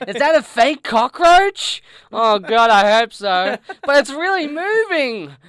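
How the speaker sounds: high, loud panicked shrieks; horrified shrieking. Acted. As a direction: shriek in rising horror. Voicing a horrified audience member.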